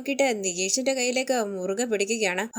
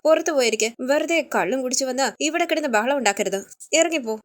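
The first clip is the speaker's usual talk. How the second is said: plain reading voice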